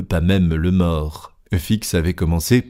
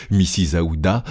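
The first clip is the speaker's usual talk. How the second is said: plain reading voice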